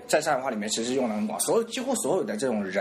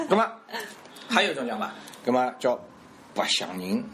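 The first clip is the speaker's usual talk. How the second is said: normal talking voice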